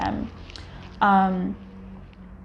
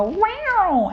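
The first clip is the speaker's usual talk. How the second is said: cat's innocent wow; 12 semitones higher than usual. Retold or imitated. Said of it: "they'll go".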